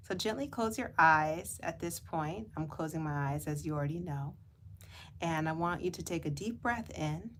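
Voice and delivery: gentle meditation voice with long pauses; guided meditation. Real, not acted. Guide asking listeners to close eyes and float, guided meditation. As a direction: lead them gently inward, lingering on words.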